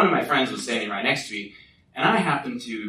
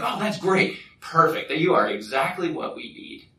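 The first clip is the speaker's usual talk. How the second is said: lightly acted, higher-pitched delight; delighted recruiter; 6 semitones higher than usual. Recruiter welcoming a reluctant volunteer, delighted. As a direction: accept the volunteer with mock glee.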